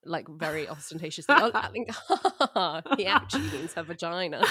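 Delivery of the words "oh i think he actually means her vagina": louder laughing, a show-off chuckle; ostentatious laughing. Pretend. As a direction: laugh loudly to show you got the joke. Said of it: "laughs at the jokes ... ostentatiously".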